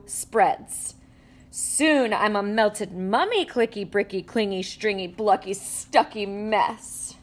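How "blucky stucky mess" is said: usual speaking voice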